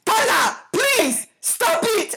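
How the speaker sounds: high, loud mock exasperated plea; imitated arguing boyfriend. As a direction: imitate an angry African boyfriend pleading. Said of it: "one time we were arguing, and he was like".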